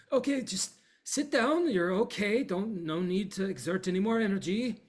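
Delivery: calm the exhausted pair down; Yara soothing. Acted. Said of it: "Yara says".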